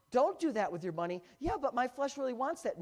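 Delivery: loud, high pleading flesh; mock debate with your own wants; inner voice arguing. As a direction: act out the tempted self arguing back. Acting inner argument.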